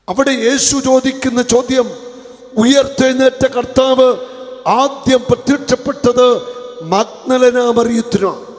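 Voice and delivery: loud, high sermon declaration; fiery preaching. Acted. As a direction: proclaim it to the congregation. Preaching at full force. (ഉച്ചത്തിലുള്ള പ്രസംഗം)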